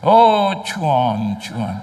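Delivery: mock preacher's sigh. Mocking a pastor's dramatic plea. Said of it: "Pendeta itu".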